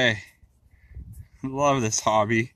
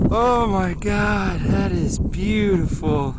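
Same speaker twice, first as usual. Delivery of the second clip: slow awed delight; 6 semitones higher, 4 dB louder, slower than usual. Real, not acted. Admiring the dug coin.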